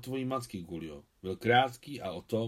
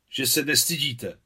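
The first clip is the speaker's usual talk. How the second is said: louder, higher reproach; tearful outcry; 4 semitones higher, 6 dB louder than usual. Acted. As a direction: cry out indignantly, hurt. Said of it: "vykřikla se slzami v očích".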